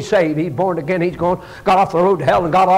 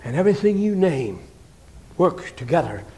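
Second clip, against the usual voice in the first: normal talking voice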